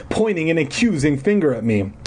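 plain reading voice

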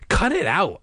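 high, irritated outburst; snapping. A narrator's reading. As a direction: snap it at her, acted. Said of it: "I snapped".

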